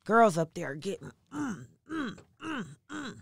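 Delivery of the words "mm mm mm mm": short, soft, higher-pitched "mm" grunts; imitated dancing grunts. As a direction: punch out little rhythmic hums, softly. Imitates girls dancing at the party.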